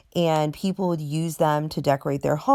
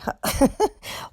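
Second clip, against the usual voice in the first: quick breathy giggle at her own slip; short sheepish laugh; embarrassed giggle; 12 semitones higher than usual. Real, not acted. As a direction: laugh off the mistake lightly.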